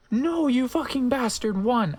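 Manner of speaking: hammed-up high yell. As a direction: scream at the villain. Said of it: "I yielded".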